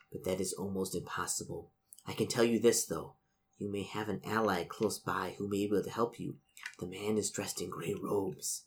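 put-on soft, hushed warning from a stern old noblewoman's portrait; hushed, ominous portrait voice; ghostly portrait. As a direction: speak low-volume, like a haunted painting warning a stranger.

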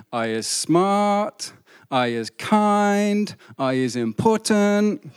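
put-on slow, drawn-out Mississippi accent; slow Southern Mississippi mantra. Maid teaching girl her mantra, slow Mississippi accent. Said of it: "that's my Mississippi accent".

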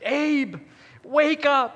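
mother insistently waking her son. Retold or imitated. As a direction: mom pressing her son awake.

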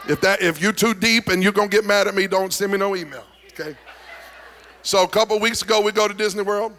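ordinary delivery

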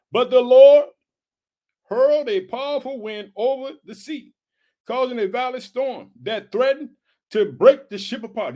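declaimed verse with a loud opening; scripture reading. Acted. Preacher reading of the storm to the congregation, proclaimed scripture. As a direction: proclaim the verse, pausing for weight.

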